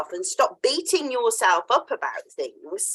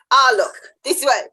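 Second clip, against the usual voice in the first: louder, brighter Australian "Ah, look"; a punchier Australian "Ah, look", pushed out louder; 3 semitones higher, 7 dB louder than usual. Retold or imitated. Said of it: "it's very Australian".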